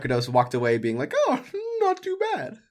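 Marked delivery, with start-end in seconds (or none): 1.3-2.6 very high, drawn-out musing; mock contentment. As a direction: squeak it out slowly, mildly impressed. Imagined reaction of a pleased asker.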